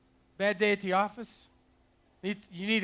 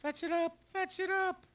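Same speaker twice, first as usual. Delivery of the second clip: much higher, coaxing the dog; dog-urging voice; 12 semitones higher, 3 dB quieter than usual. Real, not acted. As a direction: chirp it up to encourage him.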